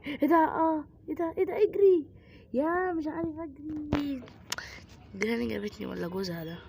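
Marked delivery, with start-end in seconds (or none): 0.1-4.2 sudden fright, shouting; panicked yelp. Real, not acted. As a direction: jump scare, yell to flee. Granny suddenly appears.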